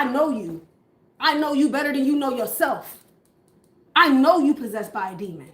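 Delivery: enraged abuser yelling at his partner; abusive partner ranting. Acted. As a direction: tear into her, rising to a shout.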